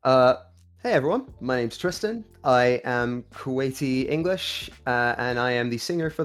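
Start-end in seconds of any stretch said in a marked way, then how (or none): none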